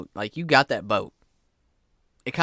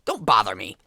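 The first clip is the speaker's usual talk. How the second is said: dismissive fisherman; 5 semitones higher than usual. Acted. Fisherman shooing player.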